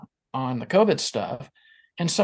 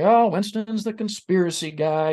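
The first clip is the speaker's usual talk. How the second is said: higher, louder mock voice of a teasing coworker; 7 semitones higher, 4 dB louder than usual. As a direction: poke fun like a ribbing colleague.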